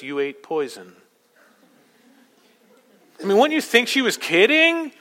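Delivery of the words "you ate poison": low, quiet mock-deadpan delivery. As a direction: drop it flatly, as if nothing is wrong.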